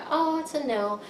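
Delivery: casual, shrugging no with no weight; a mild, offhand no. Shown as a demonstration. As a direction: toss off "no" lightly, like it hardly matters. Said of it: "Sometimes we get a no that's just like".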